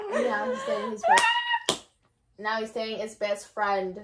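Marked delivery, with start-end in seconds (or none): 0.1-1.8 breaking into a high crying wail; sobbing it out loud. Real, not acted. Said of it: "that why you're crying?".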